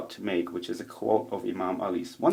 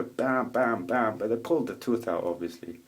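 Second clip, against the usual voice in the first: normal talking voice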